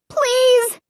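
high, panicked begging; desperate plea. A narrator's reading. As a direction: plead in terror. Narrator being smothered, begging.

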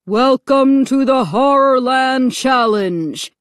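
slow, loud, menacing announcer; booming, frightening voice. A narrator's reading. Loudspeaker voice welcoming victims to the challenge, booming. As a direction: boom it out slowly like a loudspeaker announcement. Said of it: "boomed a deep, frightening voice over a loudspeaker".

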